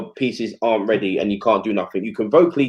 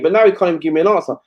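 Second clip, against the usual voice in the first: usual speaking voice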